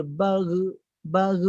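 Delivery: BAR with an extra vowel released after it, 'baru'; wrong bounce. Shown as a demonstration. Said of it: "tak ada". (bar dengan pantulan yang salah)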